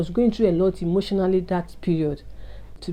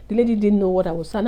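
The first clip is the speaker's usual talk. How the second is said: usual speaking voice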